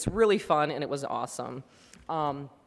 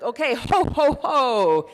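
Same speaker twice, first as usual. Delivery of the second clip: high, loud staged ho ho ho; fake ho ho laugh; 8 semitones higher, 7 dB louder, slower than usual. Yoga teacher leading the room in ho ho ho. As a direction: boom out a cheery forced laugh. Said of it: "everybody's doing the noises".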